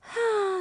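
long exasperated sigh; heavy sigh. A narrator's reading. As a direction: let out a big sigh. Narrator sighs aloud.

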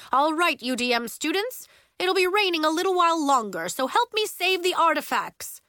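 loud, high, quick teacher's orders; rally the class in an emergency; urgent, projected instructions; calling out. A narrator's reading. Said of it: "Miz Starr called".

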